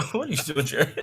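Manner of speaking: helpless giggling while being tickled. Real, not acted. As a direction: laugh through the words, squirming. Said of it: "You have been tickling me all night".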